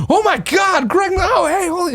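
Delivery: very high squealing fan voices; excited fans. Retold or imitated. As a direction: mimic starstruck fans gasping. Fans stop Greg at Mania.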